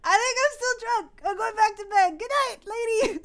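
high-pitched, joking brush-off; playful retold sign-off; mock dismissal. Acts out brushing off the ghost.

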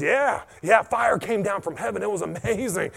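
high-pitched mock enthusiasm; excited. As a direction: gush like an awestruck king. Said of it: "Ahab's all excited about this".

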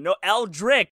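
exasperated insistence. Retold or imitated. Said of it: "I'm like".

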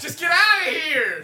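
high, playful get-out-of-here; mock exasperated. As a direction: shoo him off in fake disgust. Mock outrage repeated.

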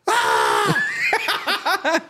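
high hammed-up child's cry; mock kid wail. Retelling the Australian kid crying on the flight, high mock wail. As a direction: wail like a bawling little kid. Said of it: "he crying".

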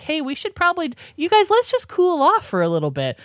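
urging friends to cool off. Retold or imitated. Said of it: "elves are like".

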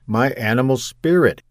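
ordinary delivery

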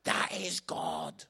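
very slow, weighty pronouncement; awed declaration. Acted. Preacher answering the astonished strangers, slow awed declaration.

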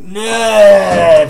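loud mock kid yell; wrzask dziecka. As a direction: imitate a kid bellowing in protest. Kids erupt when told bedtime.

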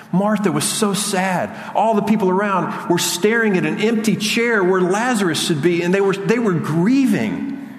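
pleading, fervent preacher voice; impassioned preaching. Acted. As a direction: preach it with rising urgency, quickly at first.

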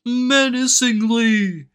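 high, slow, loud dramatic narrator; quoting the SpongeBob meme, slow and rising to a loud high finish. Retold or imitated. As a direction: draw out menacingly like a cartoon narrator. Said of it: "the fucking SpongeBob and Patrick fucking thing".

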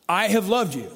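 "I have loved you" with loved pressed hard and falling; emphatic. Acted. Preacher quoting God to the congregation, emphatic. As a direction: state it firmly as God, stress on loved. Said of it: "It's emphatic. It's a statement".

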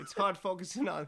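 cracking up mid-sentence; giggling through the words; laughing. Real, not acted. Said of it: "hard to play these card games".

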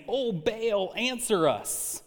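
a high, drawn-out plea to Baal; lightly hammed-up desperate cry; mock prophets calling out. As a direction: call up to the god, slowly stretching answer us.

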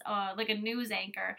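normal talking voice

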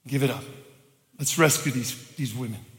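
low and soft, dropping away; hushed plea. Retold or imitated. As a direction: re-enact a last subdued appeal to the church. Acts out final plea to church.

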